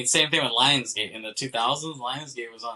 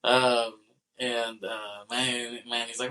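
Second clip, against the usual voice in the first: normal talking voice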